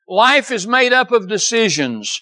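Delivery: ordinary delivery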